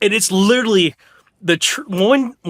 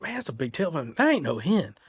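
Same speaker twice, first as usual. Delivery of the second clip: hushed awe; 7 dB quieter than usual. Retold or imitated. Hunter whispering on spotting gobbler.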